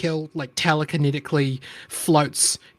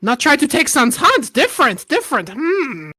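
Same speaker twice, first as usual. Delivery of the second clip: high, halting goblin speech; broken-English little goblin; 7 semitones higher than usual. Acted. GM voices the goblin.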